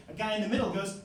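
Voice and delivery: usual speaking voice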